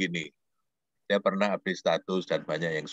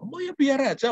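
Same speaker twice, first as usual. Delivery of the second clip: pitched way up, offhand; high student voice; 3 dB louder than usual. Retold or imitated. Lecturer voicing the student brushing it off, high pitched. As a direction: toss it off as the student.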